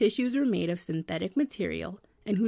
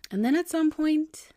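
high and loud, leading to the twist; dramatic build-up; 9 semitones higher, 5 dB louder than usual. Real, not acted. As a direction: build suspense for the reveal.

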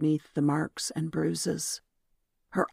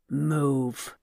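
growled. A narrator's reading. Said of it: "Raoul growled".

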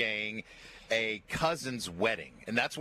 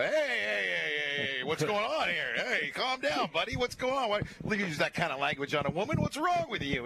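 high, raised mock call to break up a fight; stepping in between two arguing people, calling out; retold calling out; 7 semitones higher than usual. Storyteller, stepping into a stranger's argument, calls out high and raised.

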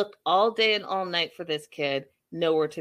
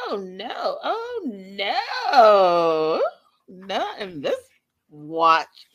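indignant no; 4 dB louder, much slower than usual. Real, not acted. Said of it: "indignant refusal".

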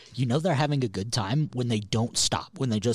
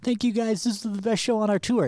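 high, louder hammed-up stage gush; mock band frontman; 12 semitones higher, 4 dB louder than usual. Complaining about bands, high mock stage thanks. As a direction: belt it high like a fake thank-you.

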